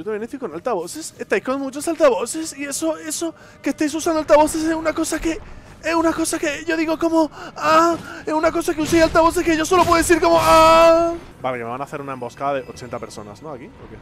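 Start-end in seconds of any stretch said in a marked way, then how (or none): 3.6-11.1 llanto fingido. Pretend. Lamento fingido en voz aguda.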